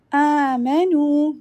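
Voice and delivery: "Amanu" with a light ayn from the bottom of the throat, slow. Shown as a demonstration. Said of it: "Make sure your amanu is from the bottom of the throat".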